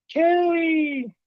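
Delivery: high, drawn-out ghostly call; eerie sing-song calling of a name; spooky name call. Retold or imitated. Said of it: "that typical spooky, name calling thing".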